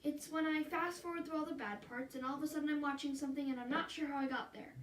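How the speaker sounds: ordinary delivery, nothing marked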